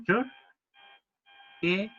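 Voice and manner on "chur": "chur" said with almost no vowel between ch and r; "chur" as one consonant cluster. Shown as a demonstration. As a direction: clip "chur" down to ch and r. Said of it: "como si no hubiera ninguna vocal entre medio".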